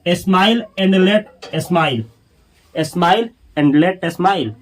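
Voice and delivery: very slow, every word spaced; slow model reading. Shown as a demonstration. Teacher drilling the phrase for students, very slow. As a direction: read it slowly for the class. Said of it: "teacher models the English phrase".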